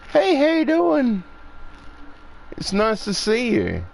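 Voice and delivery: high, sing-song hello; friendly greeting to animals. Retold or imitated. Showing how they greet the animals, in a high sing-song voice.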